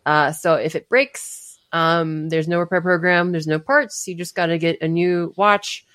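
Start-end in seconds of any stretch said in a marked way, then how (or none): none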